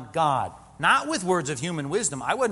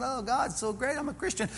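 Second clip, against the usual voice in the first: mock shallow churchgoer; 8 semitones higher, 3 dB quieter than usual.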